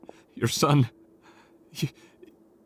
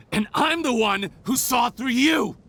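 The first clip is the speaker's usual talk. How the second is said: triumphant, higher-pitched jab; gloating accusation; 6 semitones higher than usual. Acted. A cornered man gloats at the head nurse, triumphant. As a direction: crow that you caught them out.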